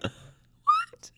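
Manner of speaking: shrill, stunned "What?" rising way up; a disbelieving, sky-high "What?"; shocked what. Retold or imitated. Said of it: "And I was like".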